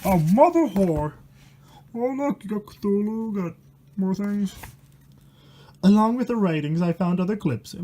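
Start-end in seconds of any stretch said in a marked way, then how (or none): none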